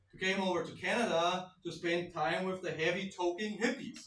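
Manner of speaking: ordinary talk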